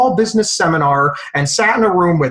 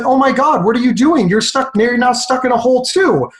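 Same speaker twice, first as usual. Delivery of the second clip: higher-pitched mock dismay; acted alarm; 7 semitones higher than usual. Trapped man to the helper who jumped in, acted alarm. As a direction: act aghast, pitch jumping up.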